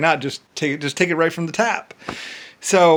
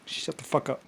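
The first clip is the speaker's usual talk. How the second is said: hushed retold insult; 13 dB quieter than usual. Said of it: "someone was like".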